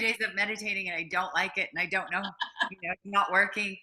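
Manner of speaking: loud, exasperated complaint; frustrated retelling. Retold or imitated. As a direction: gripe loudly through a retold failure. Retelling her frustration with meditation.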